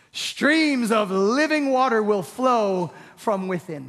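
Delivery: slow, loud, high-pitched proclamation. Acted. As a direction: call out across a crowd, phrase by phrase. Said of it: "said in a loud voice".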